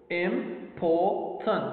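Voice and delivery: "Important" slow, as im, por, tant; three separate syllables. Shown as a demonstration. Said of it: "We got three syllables".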